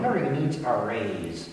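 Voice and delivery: a soft, lightly voiced parrot puppet; macaw puppet voice. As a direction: let the puppet bird chime in quietly. Murray asks for raise.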